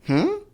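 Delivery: high, questioning hum; acted confused "hmm?" shooting up in pitch; puzzled hmm. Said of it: "You'd be like".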